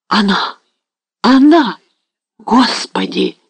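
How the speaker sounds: shaken cry rising high, then slow; overwhelmed, calling to God; agitated and bewildered. A narrator's reading. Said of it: "произнёс растерянным, взволнованным голосом".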